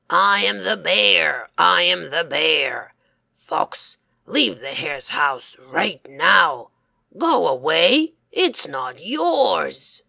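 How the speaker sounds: loud bear bellow; yelling bear. Acted. Bear yelling at the fox to leave, loud bellow. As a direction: roar it to frighten the fox. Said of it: "the bear yelled loudly".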